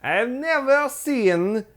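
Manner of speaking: high, slow mock Russian accent. Repeating the Frenchman's denial in a high, slow mock Russian accent. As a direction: stretch it out in a hammed-up Russian accent. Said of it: "you sounded a little bit like John Malkovich's Russian".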